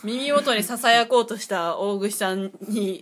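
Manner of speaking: slow, loud play-by-play; announcer voice. Acted. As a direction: call it like a sportscaster. Mock play-by-play of near-kiss.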